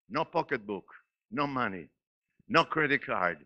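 quiet, dwindling list; hushed list. Acted. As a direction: drop the voice right down for each item. Soft list after preaching.

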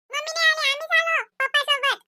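put-on high childish voice telling grandma; a little kid answering granny; small child. (लहान मुलाचा उंच आवाज)

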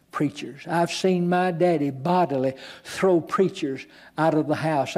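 normal talking voice

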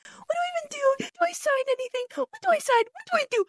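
tiny, very high, softened damsel voice; mock helpless whimper. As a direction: plead in a squeaky mock-helpless falsetto. Mimics Natalie playing helpless.

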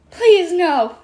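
high, loud pleading wail; drawn-out dwarf plea. A narrator's reading. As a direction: wail it in dismay. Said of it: "Achman wailed".

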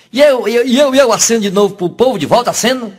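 high, keen questioning voice; the president eagerly asks the fortune teller; eager excited president. Acted.

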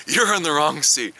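lightly voiced stewardess scolding; stern stewardess. Stewardess tells the old lady she is in the wrong seat, lightly voiced. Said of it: "is like".

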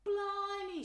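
high, loud amazement; amazed blimey. Acted. Twin amazed at Harry's scar, high and loud. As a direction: gasp it out awed.

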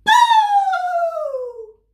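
very high, loud scare shout. Acted. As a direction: jump out and yell it. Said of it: "shouted a little gray mouse".

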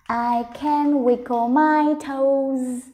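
"I can wiggle my toes" slow but in one connected run; joined-up slow reading. Shown as a demonstration. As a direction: link the words of "I can wiggle my toes" without breaks, still drawn out. Teacher rereads the sentence joined up. (đọc chậm liền câu "I can wiggle my toes")

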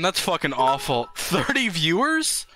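ordinary delivery

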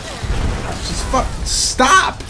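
loud outraged yelling, high; angry yelling. Real, not acted. Gamer yelling at an NPC throwing fireballs, loud and high. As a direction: yell at the fireball guy, pitch climbing.

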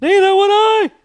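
very high and loud, mocking his own squeak; hammed-up high-pitched echo of his own slip; squeaky high. Shown as a demonstration. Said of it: "I did it on a podcast".

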